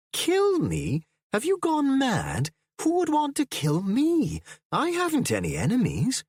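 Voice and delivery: outraged disbelief at the very idea; indignant. A narrator's reading. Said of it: "His voice was indignant".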